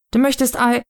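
high parental coaxing, cut off mid-word; prompting mother. Acted. Mother starting to prompt her child, high coaxing voice.

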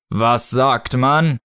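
very slow, solemn prompt; Snow Father figure. Acted. Snow Father prompting a child's thanks, slow solemn voice. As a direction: spell out each word with grave weight.

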